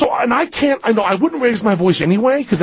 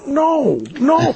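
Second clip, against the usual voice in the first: high, drawn-back no; high meek refusal; 8 semitones higher than usual. Retold or imitated. Refusing to settle it like men, high and meek. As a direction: refuse in a raised, timid pitch. Meekly refusing to fight the stranger.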